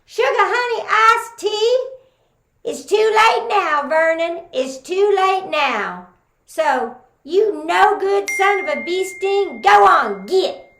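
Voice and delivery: high, loud scolding Texas auntie; mad as a wet hen. Acted. Aunt Hazel throwing Vernon out, high and scolding. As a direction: run him off like an angry aunt. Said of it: "she got mad as a wet hen".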